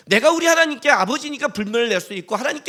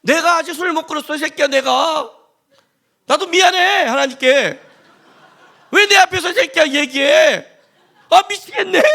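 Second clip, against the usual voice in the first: louder, higher mock drunk ranting with laughs; drunk quarrel; 4 semitones higher, 6 dB louder than usual. Drunk friend snapping at drinking buddy, mock angry and laughing. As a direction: act a drunk churchgoer snapping back, half laughing. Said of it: "술 먹고 싸우는 거예요".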